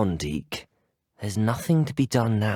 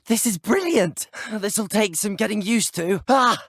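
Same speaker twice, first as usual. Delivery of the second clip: high, thrilled cry; exhilarated; 10 semitones higher, 3 dB louder than usual. Acted. Student getting used to a brilliant new ride, exhilarated. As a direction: call out mid-ride, delighted.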